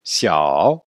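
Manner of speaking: Xia dipping then rising; third tone Xia. Shown as a demonstration. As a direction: let Xia fall low and climb back up. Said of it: "il descend puis après qu'il remonte". (Xia au troisième ton, descendant puis montant)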